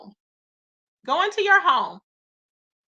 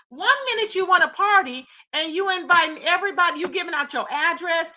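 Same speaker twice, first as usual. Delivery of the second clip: ordinary talk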